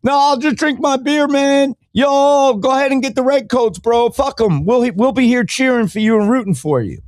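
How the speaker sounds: high, careless mock slacker voice; lazy bar patron. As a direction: dismiss the war like a lazy drinker. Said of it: "there's people like".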